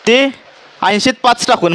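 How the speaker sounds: normal talking voice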